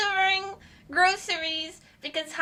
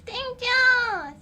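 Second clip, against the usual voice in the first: high cute thanks; 7 semitones higher, 6 dB louder than usual. Pretend.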